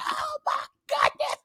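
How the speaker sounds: high panicked scream from the back of the bike; shrieking, slowed terror scream. Retold or imitated. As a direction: scream it like you want off right now. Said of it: "screaming at the top of my lungs".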